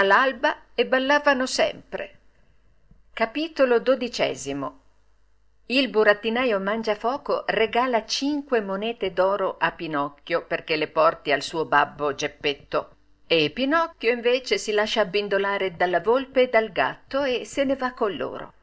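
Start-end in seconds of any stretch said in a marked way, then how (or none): none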